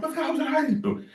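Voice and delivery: high-pitched mock alarm, voice jumping way up; retold shock.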